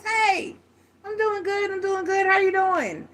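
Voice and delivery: high, cheery mock voice of a guy greeting a friend; friendly greeting. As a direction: greet your buddy in a high, bright put-on voice.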